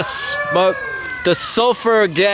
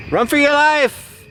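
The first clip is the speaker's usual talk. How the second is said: much higher-pitched cry of alarm; calling out a warning; 9 semitones higher than usual. Real, not acted. Man filming warns others after a near miss, high call. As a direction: call it out high, half in fun. Calling out as a firework misfires nearby.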